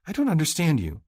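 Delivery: can't believe what he's saying; bewildered Stacy. Acted. Stacy challenges him.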